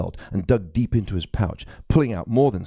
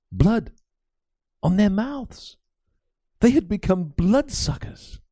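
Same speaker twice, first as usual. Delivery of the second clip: alarmed; 11 semitones higher, slower than usual. Acted. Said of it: "The man seemed genuinely alarmed".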